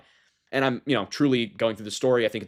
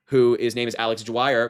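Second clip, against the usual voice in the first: ordinary talk, nothing marked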